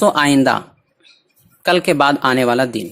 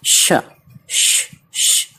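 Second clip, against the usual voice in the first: drawn-out unvoiced "shh", repeated; hushing shh sound; much slower than usual. Shown as a demonstration. Teacher showing how SHH is pronounced, hushing shh. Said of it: "इसका तलफ़ुज़ होता है".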